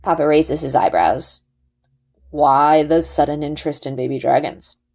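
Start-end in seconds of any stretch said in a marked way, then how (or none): 2.3-4.8 a lightly voiced, steady father explaining; a hint of a calm, knowing father; Papa's voice. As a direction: answer patiently like a wise dad. Papa questioning his daughter.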